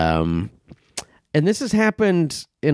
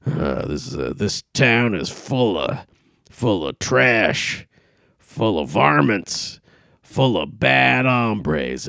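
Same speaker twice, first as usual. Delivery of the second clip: mocking self-important comic; 3 semitones lower than usual. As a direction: puff yourself up as the lone truth-teller. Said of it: "They have this feeling like".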